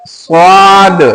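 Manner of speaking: "स्वाद" heavy, with a long rounded aa; thick स्वाद. Shown as a demonstration. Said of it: "हर्फ को मोटा कर देंगे तो". (मोटा करके "स्वाद")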